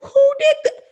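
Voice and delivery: incredulous wait-what, then a shrieked who did that; shocked outrage. Retold or imitated. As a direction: play David hearing the news, stunned. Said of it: "he was like".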